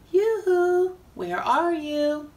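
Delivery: loud, high, drawn-out sing-song call. Acted. God calls to hiding Adam and Eve, a loud, high, slow call. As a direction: hoot it out across the garden, long and slow. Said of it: "God called out to them".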